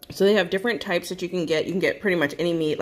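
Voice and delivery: normal talking voice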